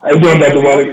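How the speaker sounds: caller too close to the mic, echoing; loud phone-line echo on the voice. Real, not acted. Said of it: "You're echoing".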